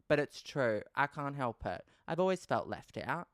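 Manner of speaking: ordinary talk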